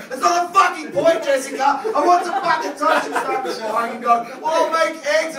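loud, high furious rant at the wife; angry rant. Retold or imitated. Husband ranting at wife Jessica over toast, loud shout. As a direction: yell it like the toast is everything.

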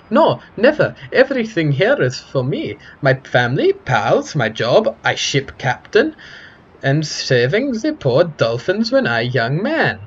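emphatic, hammed-up accented voice; insistent foreign-accented character. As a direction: list it proudly in a thick foreign accent.

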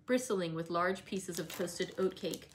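plain reading voice

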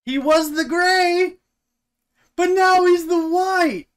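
slow, high-pitched mock-outraged shout; comic yelling. Co-host heckling about Gandalf the Grey, comic yelling. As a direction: holler it high and loud, stretching the colours.

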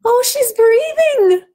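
high, loud, slow cry of relief; shouted. Acted. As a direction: shout it out, astonished. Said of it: "Diane shouted".

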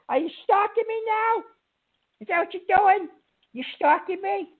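usual speaking voice

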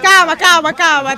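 calling out loud. Acted. Confronted by armed gang.

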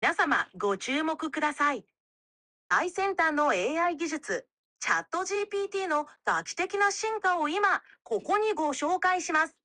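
high, fast, hyped Japanese news announcement; Japanese announcer. Shown as a demonstration. AI switching to Japanese for the announcement, high and fast. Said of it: "Speak it in Japanese instead".

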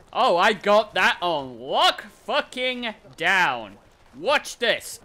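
usual speaking voice

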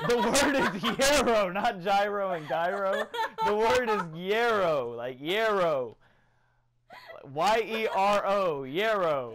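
loud, drawn-out laughing correction; slow, booming mock lecture on a word; mock insistence. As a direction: slowly belt it out through laughter. Mock-insisting on a silly pronunciation.

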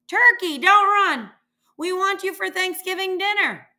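high, loud shout after a runner; calling after. A narrator's reading. Mister Moose chasing the fleeing turkey, shouting after him.

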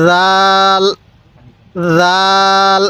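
soft buzzing start on রাল, held long; soft যাল. Shown as a demonstration. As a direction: say রাল with a soft "dh" as in "this" in place of the first sound. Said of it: "এই দালটা নরম করে উচ্চারণ করব".

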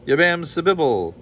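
loud, high incantation with a falling end; grand, ringing magical pronouncement; intoned. A narrator's reading. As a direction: chant it like a magic spell. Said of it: "the bone intoned".

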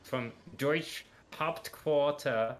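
slow, half-French bad German accent; mock German accent. Giving orders to a teammate, a slow, bad German accent. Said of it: "it's like a mixture of French now".

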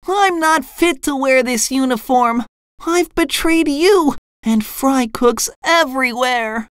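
high cartoon voice, mock tearful; SpongeBob. A narrator's reading. Ashamed fry cook confessing to boss, cartoon sobbing. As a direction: confess in a squeaky, overwrought lament. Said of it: "SpongeBob sobbed".

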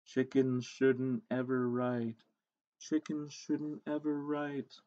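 soft, slow monotone chant; hushed, drawn-out voice of self-doubt; little inner voice. A narrator's reading. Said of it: "a little voice inside her kept saying".

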